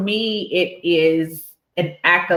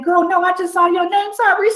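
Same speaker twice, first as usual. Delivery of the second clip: high-pitched, hurried retort; flustered excited apology; faster than usual. Retold or imitated. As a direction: rush it out, on the edge of laughter. Own flustered reply, retold, laughing after.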